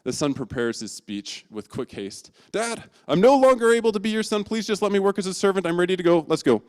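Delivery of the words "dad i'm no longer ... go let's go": much higher and louder, rushed; hasty pleading. Acted. Son rushing his rehearsed speech to his father, much higher. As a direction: blurt out the speech in a hurry.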